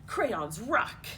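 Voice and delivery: usual speaking voice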